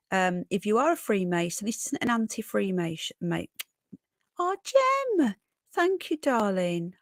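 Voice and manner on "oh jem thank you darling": high, loud-hearted cry of delight; touched surprise. Real, not acted. Streamer thanking a donor, touched surprise. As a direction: swoop way up in grateful surprise.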